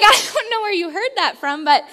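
high, loud, laughing mock concern; amused disbelief. Mom answering her toddler's grown-up complaint, loud and laughing. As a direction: gasp it out through laughter. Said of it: "And I was like".

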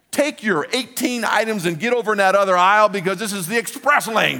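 high, loud, fired-up command; mock tough order. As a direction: boss a shopper like a champion. Pumped-up fan ordering shoppers around.

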